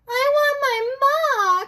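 very high, slow, drawn-out wail; crying out. Acted. Mig wails her wish into the waiting world, crying out. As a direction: bawl it out like a lost child. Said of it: "cried Mig".

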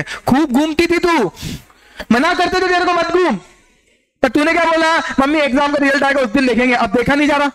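high-pitched parental telling-off; imitated scolding parent. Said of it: "हमको ही सुनना पड़ता है".

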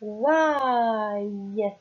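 "ouaille" drawn out clearly, like a teacher; "ouaille" slowly and clearly, for children; sounded-out syllable. Shown as a demonstration. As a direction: hold "ouaille" as one long sung-out syllable. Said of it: "et le Y se lient".

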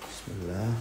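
usual speaking voice

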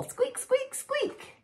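tiny high squeaky mouse; mouse squeak. Acted.

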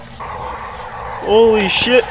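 slow, high drawn-out holy shit; long stunned exclamation; shocked. Real, not acted. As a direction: stretch it out in disbelief. Shocked in dark.

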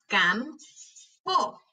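"Campo" slowly as cam, pause, po; broken into syllables. Shown as a demonstration. Teacher helping hear each sound, syllable by syllable. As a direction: break "Campo" in two. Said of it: "quiero que las quiebres entre sílabas".

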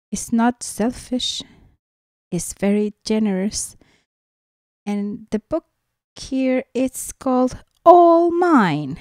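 usual speaking voice

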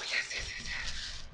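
rapid thrilled whisper; excited whisper. Real, not acted. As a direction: hiss the yeses under your breath. Thrilled by a gift.

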